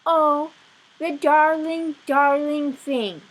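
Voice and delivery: high, loud, slow coo. Acted. Wife gushing over piglet, high and slow. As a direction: gush over the darling piglet. Mrs Watson coos.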